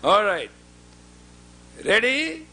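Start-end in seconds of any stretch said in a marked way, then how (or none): 1.8-2.5 high, loud ready, set; loud start call. Real, not acted. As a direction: call the count to the whole room. Calling the start again.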